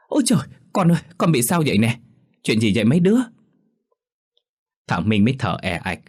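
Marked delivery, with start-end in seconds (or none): 0.1-3.2 alarmed mother. Acted. Mother panics at son.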